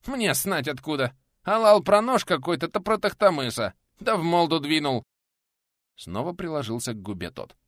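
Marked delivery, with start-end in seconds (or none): 0.0-5.0 high, protesting voice with r as l; high lisping complaint; lisping peasant, aggrieved. A narrator's reading. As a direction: explain indignantly through a split lip.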